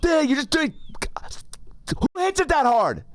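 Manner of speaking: mock the angry guy in a high whine; whiny angry passenger. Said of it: "then he just goes".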